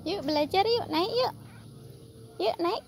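coaxing pet talk. Acted. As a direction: coax them up like a fond owner.

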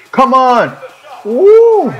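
high, loud whoop; excited cheer. Real, not acted. Gamer cheering his chance, high loud whoop. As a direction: cheer like a fan in the stands.

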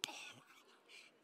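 slow, voiceless whisper of dread; dismayed whisper. Retold or imitated. Pastor watching drunk sit by new family, dismayed whisper. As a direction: breathe it out as a private groan of dismay.

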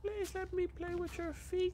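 high, soft and slow cartoon voice; pleading squeaky lemur; King Julian. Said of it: "King Julian".

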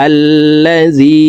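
slow chanted "আল্লাজি" with a long final ee; three-alif stretch. Shown as a demonstration. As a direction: hold the "জি" of "আল্লাজি" for three beats. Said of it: "তিন আলিফ টানিয়া পড়িতে হয়". ("আল্লাজি"-র জি তিন আলিফ টেনে পড়া)

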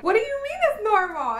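high, fast, disbelieving squeak; incredulous disbelief. Retold or imitated. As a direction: act shocked by the aunt's answer. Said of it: "And I'm like".

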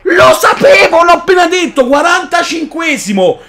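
high exasperated shout; frustrated yell. Real, not acted. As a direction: yell in annoyance. Frustrated gamer yelling.

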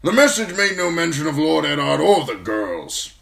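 put-on loud, high old officer's voice; irritated old commander. A narrator's reading. Commander answers young man asking after sisters, loud and irritated. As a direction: answer forcefully, with some irritation. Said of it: "He gave an irritated shrug".

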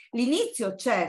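usual speaking voice

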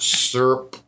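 "Syrup" as "surp", the middle vowel dropped; one-syllable syrup. Shown as a demonstration. Showing the co-host it's one syllable, clipped into "surp". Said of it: "it's one syllable, right?".

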